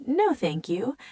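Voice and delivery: normal talking voice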